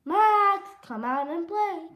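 high, loud singsong call; hollering. Acted. Froggy calling at Max's house, high holler. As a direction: holler for a friend to come out. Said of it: "he, he hollered".

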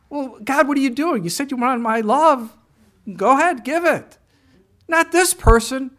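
high, loud whiny protest to God; hammed-up high loud complaint at God; mock indignant protest. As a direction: squeal it like an outraged complainer.

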